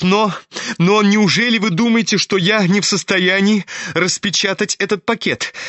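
high-pitched challenge; defiant. A narrator's reading. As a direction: throw the question out defiantly, pitched well up. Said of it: "прибавил он с каким-то вызовом". (с вызовом, высоким голосом)

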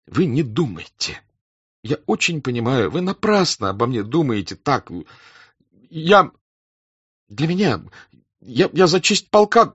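high, halting, agitated voice; flustered pleading. A narrator's reading. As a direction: stammer out a heated defence. Flustered young officer defends himself.

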